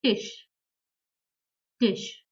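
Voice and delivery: "Tisch" said tish, short i; German example word. Shown as a demonstration. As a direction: say "Tisch" crisply, ending in sh. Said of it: "For example".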